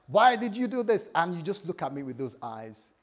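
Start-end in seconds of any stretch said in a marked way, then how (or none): 0.1-1.1 raised, louder mock complaint; mock annoyed. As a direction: play up a fake irritation. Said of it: "if I'm annoyed and I'm like".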